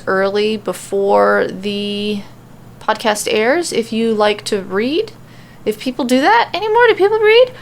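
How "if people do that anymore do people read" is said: sudden high, louder mock-incredulous question; mock disbelief. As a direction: feign shock that anyone still reads. Mock doubt about readers.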